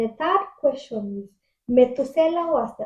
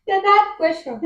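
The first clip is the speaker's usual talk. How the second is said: higher, louder, through laughter; giggly; laughing; 8 semitones higher, 6 dB louder than usual. Real, not acted. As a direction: read it while still laughing. Laughing while reading question.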